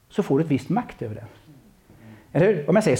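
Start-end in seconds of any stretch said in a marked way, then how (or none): none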